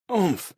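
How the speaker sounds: sharp high grunt of pain; winded grunt from a blow. Acted. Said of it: "Ed reacted to the pain".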